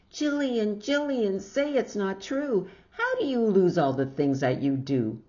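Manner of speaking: hammed-up mother scolding; imitated mother's shout. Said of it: "then she'll wake up and shout".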